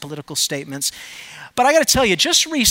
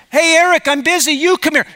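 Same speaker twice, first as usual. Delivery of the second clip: loud, higher call back; yelling back; 6 semitones higher, 5 dB louder than usual. Retold or imitated. Dad yelling back to Eric that he is busy, loud and higher. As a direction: tell him you're busy from across the house. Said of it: "And so I yell back".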